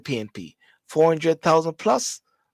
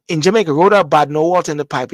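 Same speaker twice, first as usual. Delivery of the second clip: emphatic slogan; 8 dB louder than usual. Shown as a demonstration. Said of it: "repeats the phrase".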